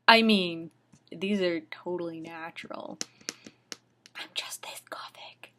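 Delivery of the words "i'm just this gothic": whispered sarcastic aside; mock whisper. Reader whispering a sarcastic aside about the contacts. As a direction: murmur the joke under your breath.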